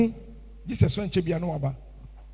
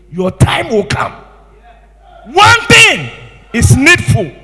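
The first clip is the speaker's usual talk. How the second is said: much higher, shouted proclamation; full-force preaching; 8 semitones higher, 12 dB louder than usual. Real, not acted. Preacher urging congregation about their calling, full shout. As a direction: declare it to the whole church.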